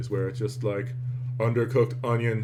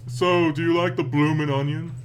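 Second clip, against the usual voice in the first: nosy American; 5 semitones higher, 3 dB louder than usual. Retold or imitated. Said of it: "where it's like".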